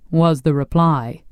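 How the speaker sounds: plain reading voice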